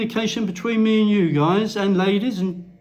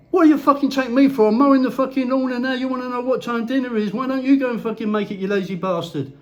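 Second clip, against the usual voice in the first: mock furious wife; 6 semitones higher, faster than usual. Wife mowing the lawn snapping at her lazy husband, mock fury. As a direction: rattle it off like a nagging wife losing it. Acting wife's furious reply.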